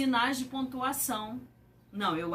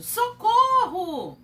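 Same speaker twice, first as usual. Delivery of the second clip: higher, louder, slow shout; cry for help; 8 semitones higher, 7 dB louder, slower than usual. Shown as a demonstration. Teacher repeating the scared exclamation, higher and louder. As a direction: call out in fright. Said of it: "Olha como muda a sinalização".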